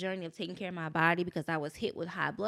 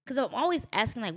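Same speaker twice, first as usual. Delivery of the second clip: normal talking voice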